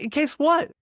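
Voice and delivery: high, loud baffled retort; acted incredulous challenge. Challenging mother-in-law's excuse, acted incredulity.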